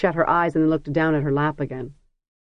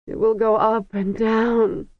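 slow, higher moan of dread; 6 semitones higher, slower than usual. A narrator's reading. Seasick princess moaning to Tanar, slow and higher. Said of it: "she moaned in Kargish".

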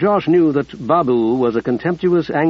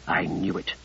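low, much softer grim hiss; 8 semitones lower, 10 dB quieter than usual. A narrator's reading. Ruddy mutters to Josh, low and hushed. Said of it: "hissed Ruddy".

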